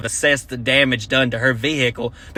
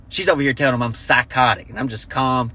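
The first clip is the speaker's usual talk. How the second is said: usual speaking voice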